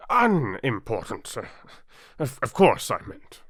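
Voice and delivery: a touch of flustered hurry; king hastily covering his mistake; hasty king. Acted. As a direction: backpedal quickly. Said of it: "the king hastily said".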